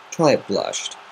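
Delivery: ordinary delivery, nothing marked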